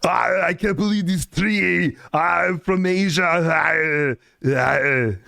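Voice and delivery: hammed-up Arnold-style character voice; Trigon Bai. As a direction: do a bad Arnold passed off as another character. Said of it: "[name] has a character called Trigon Bai".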